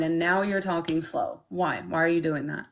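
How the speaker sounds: ordinary delivery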